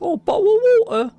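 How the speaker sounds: high, loud posh-English mockery; mock English accent. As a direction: parody a stuffy English accent. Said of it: "when non-English people hear English people".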